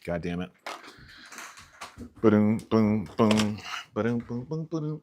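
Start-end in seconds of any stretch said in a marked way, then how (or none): 2.2-5.0 beatboxing drum sounds. Real, not acted. Playful drum noises while working.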